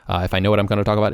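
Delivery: rapid-fire burst of words; speed-talking demonstration; very fast talking. Shown as a demonstration. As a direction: rattle it off at top speed. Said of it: "it's very fast, and I can do that".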